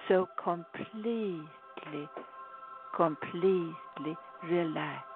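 guided meditation. Acted.

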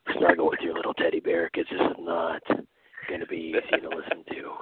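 deliberate Batman-style storyteller. Acted. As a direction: set the scene like a brooding dark vigilante. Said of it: "is Batman telling us the story".

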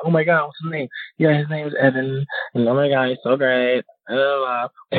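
put-on high, gushing valley girl voice.

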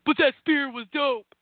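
slow, sneering put-on troll voice; mocking a Twitter troll. Mocking fans who troll Roman Reigns, slow put-on sneer. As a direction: drag it out like a smug online hater. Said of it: "be a Twitter troll".

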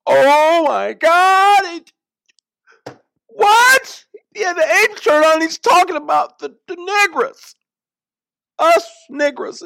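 hammed-up shrieking panic, very high and loud at the start; mock outrage. Mimicking outraged social media users losing their minds, hysterical mock outrage. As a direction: lose your mind in exaggerated, high-pitched horror. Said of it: "we lose our collective minds".

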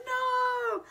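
very high, tight no; a tiny high squeal of no; squeaked no. Retold or imitated. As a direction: squeak it out in dread. Panicked refusal.

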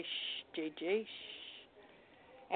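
soft unvoiced hush, slow; hushed slow whisper, barely there; toddler's whisper. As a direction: shush an adult like a little girl at prayer. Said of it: "And she'll say".

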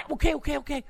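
high, hurried okays without the shouting; placating. Acted. Undecided voter calming an outraged friend, high placating. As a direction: talk the shouter down, flustered.

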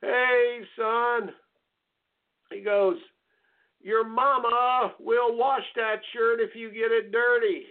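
mock coach hollering from the dugout; coach's voice. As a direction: play the gruff coach teasing the boy. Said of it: "the coach comes out of the dugout and he goes".

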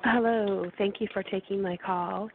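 ordinary delivery

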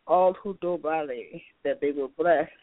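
ordinary talk

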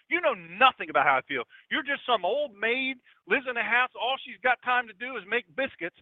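high-pitched, loud outburst that turns into a scornful rant; angry yelling. Acted. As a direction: lash out at the kindly old lady. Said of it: "yelled at her again".

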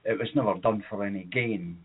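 usual speaking voice

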